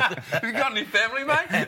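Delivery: high, laughing retelling of the line; gleeful echo of the sledge; laughing repeat. Retold or imitated. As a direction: relish the punchline while laughing.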